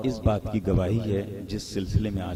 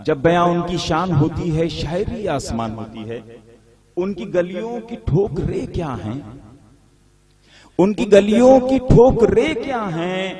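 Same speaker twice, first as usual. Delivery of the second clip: poetry recitation; 6 semitones higher, 3 dB louder than usual. Acted. جی، کہ شعر سنیے۔.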